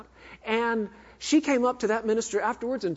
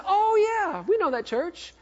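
imitated eager recognition; 12 semitones higher than usual. Grandmother recognizes the preacher's church, eager. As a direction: pitch it up like a pleased acquaintance.

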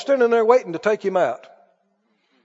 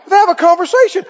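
ordinary delivery

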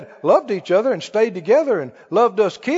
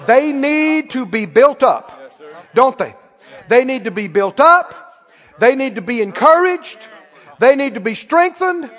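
driving, punched preacher delivery; emphatic preaching; 3 semitones higher, 4 dB louder than usual. Real, not acted. Preacher urging the congregation to build people up, emphatic preaching. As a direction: build it with insistent repeated phrases.

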